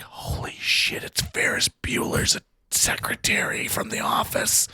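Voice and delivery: hushed aside to a friend; secret whisper. Retold or imitated. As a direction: whisper it so she won't hear. Aside to friend beside star.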